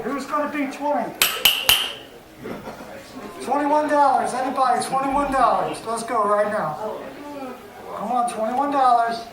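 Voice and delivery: brisk auctioneer calling for bids. Acted. Runs a painting auction.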